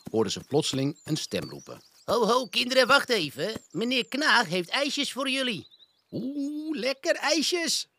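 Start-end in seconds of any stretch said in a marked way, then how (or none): 2.1-5.7 put-on high, loud teasing call to the children; a hidden grown-up luring the kids with ice cream; mock call. The voice calls to the bear cubs, hammed-up and high. Voice calling from the path. 6.1-7.9 high, slow cub's voice, delighted; a small child drooling over ice cream; little bear cub. Acted. Borre squeals about ice cream, high and slow. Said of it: "zei Borre".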